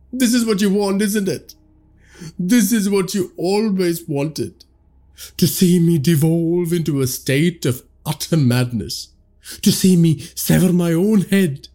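high, bitter anguished cry; high accusing outcry. A narrator's reading. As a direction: accuse the unseen tormentor. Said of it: "Tarek cried".

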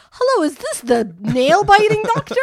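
mock phone caller. Prank call to the nail doctor.

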